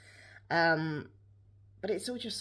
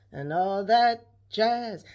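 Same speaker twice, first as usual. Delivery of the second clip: showbiz sing-song; 6 dB louder, much slower than usual. Pretend. Repeating the phrase in a put-on voice.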